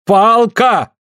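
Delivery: high exasperated call; irritated cry. A narrator's reading. Suль waves the stick at Andrei's nose, irritated cry. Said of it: "бегает раздражённо по кузне".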